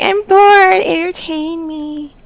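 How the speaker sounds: high, drawn-out kiddie whine; bored kid. Acted. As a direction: imitate a bored child demanding attention, dragging out the words. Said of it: "But kids, they're just like".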